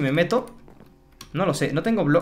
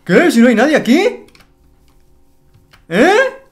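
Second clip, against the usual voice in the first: much higher, much louder shocked yell; shocked shout; 9 semitones higher, 10 dB louder, slower than usual. Real, not acted. Gamer finds his villagers vanished, shocked high yell. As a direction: shriek in disbelief that they're gone. Nobody is there after portal.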